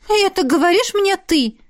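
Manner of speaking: high incredulous retort; scoffing. A narrator's reading. Friend answering a jab about manliness, scoffing. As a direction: throw it back with a snort. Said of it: "фыркнула подруга".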